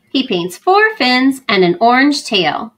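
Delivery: ordinary delivery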